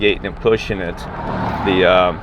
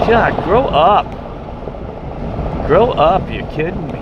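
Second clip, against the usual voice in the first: real exasperation; 9 semitones higher than usual. Real, not acted. Ranting at grown adults over stupid drama, real exasperation. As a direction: scold them in sheer disbelief.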